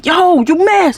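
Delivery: high-pitched mock holler; imitated distant yell. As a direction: imitate a yell heard through a window. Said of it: "hearing it through the fucking window".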